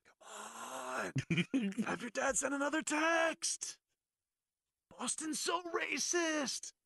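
drawn-out mock complaint about Boston; Magic Johnson impression. Mocking Magic griping on the show, high and slow. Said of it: "He's gonna be Just be like".

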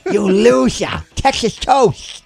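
high lisping sneer, slow; slushy lisp. Retold or imitated. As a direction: drag out a lisping insult. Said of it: "he had a lateral lisp".

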